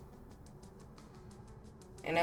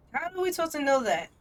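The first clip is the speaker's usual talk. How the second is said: frustrated; 8 semitones higher, 5 dB louder than usual. Real, not acted. Frustrated at game objective.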